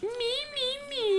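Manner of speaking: very high needy squeal. Pretend. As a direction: squeal it like a child.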